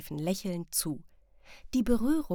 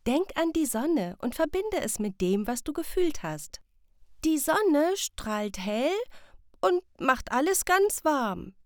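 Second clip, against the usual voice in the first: a lightly voiced mother, higher, slowing on the sun; gentle mother; 7 semitones higher, 4 dB louder than usual. Mother guiding Sam through the riddle, gentle and higher. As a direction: coax the child toward the answer.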